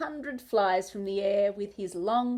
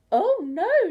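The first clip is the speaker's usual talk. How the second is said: high, loud flustered cry; fussy high exclamation; fluttering; 10 semitones higher, 7 dB louder than usual. A narrator's reading. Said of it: "she fluttered".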